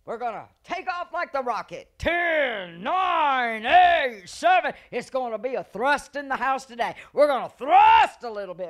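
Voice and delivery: loud, high rocket countdown shout; shouting hype. Retold or imitated. Preacher hyping the church for takeoff, shouting. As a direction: pump the church up like a launch. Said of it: "sometimes we even say".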